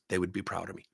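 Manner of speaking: low, subdued retold reply; changed, calmer tone. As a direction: answer softly, the anger gone. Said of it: "Tone difference".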